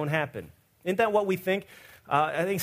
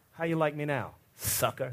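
quiet smug taunt; 3 semitones lower, 6 dB quieter than usual. Pretend. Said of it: "I'd say".

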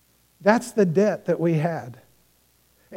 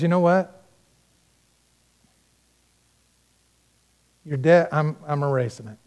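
gentle forgiving king; 5 semitones lower than usual. Retold or imitated. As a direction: let him off the hook tenderly. King forgives the servant's debt.

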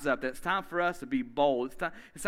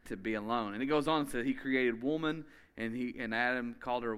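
ordinary delivery

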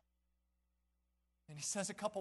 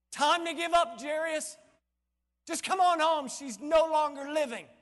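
raised, high voice; urgent dismissal; 6 semitones higher, 6 dB louder than usual. Acted. Messenger urging Jairus to come home, urgent dismissal. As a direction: call him home loudly.

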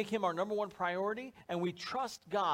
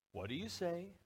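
lilting question rising on "say"; parental sing-song prompt; 6 dB quieter than usual. Acted. Parent prompting his little guy at gift time, sing-song. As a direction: coax a thank-you in a sing-song. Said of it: "little sing-song almost".

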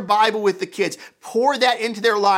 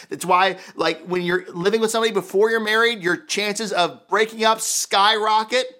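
usual speaking voice

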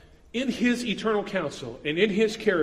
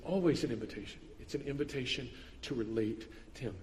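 normal talking voice

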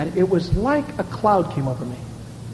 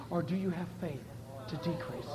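hushed; 5 semitones lower, 17 dB quieter than usual. Acted. God's question, dropped to hush.

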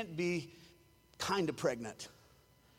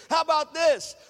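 high, loud shouted rebuke; loud stern rebuke; 6 semitones higher, 8 dB louder than usual. Retold or imitated. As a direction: bark it like a fed-up Jesus. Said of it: "Jesus said".

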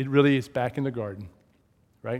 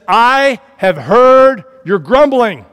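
slow, loud, much higher divine pronouncement; God's booming voice; 8 semitones higher, 13 dB louder, slower than usual. Acted. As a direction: thunder it down as God. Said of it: "He said".

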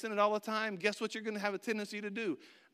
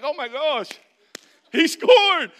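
much higher, much louder cheering; thrilled parents; 8 semitones higher, 15 dB louder, slower than usual. Retold or imitated. Parents in the stands, screaming with joy. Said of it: "my parents are like".

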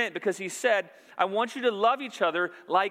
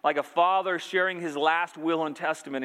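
usual speaking voice

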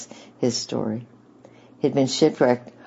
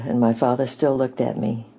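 usual speaking voice

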